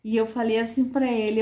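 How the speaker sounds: usual speaking voice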